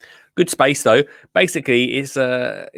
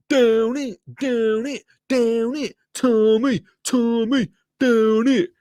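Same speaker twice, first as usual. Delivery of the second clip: rowdy jeering pub banter; 9 semitones higher, slower than usual. Pretend. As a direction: egg him on like a pub crowd. Chanting at friends on screen.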